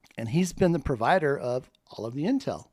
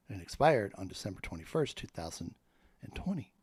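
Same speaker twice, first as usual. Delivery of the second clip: hushed whisper of a secret date; low conspiratorial whisper; 5 semitones lower, 6 dB quieter than usual. Real, not acted. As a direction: lean in and whisper it. Confides key date.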